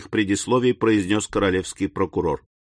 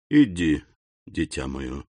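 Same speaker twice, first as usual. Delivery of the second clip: тихо и ниже, ласково; отпусти сына мягко; tender; 8 semitones lower, 4 dB quieter than usual. A narrator's reading. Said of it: "сказал он". (отец ласково отпускает сына)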